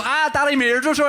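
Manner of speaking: high-pitched mock child's wail; complaining small child. Three-year-old complaining to dad in the car, high mock wail. Son complaining of sore ears.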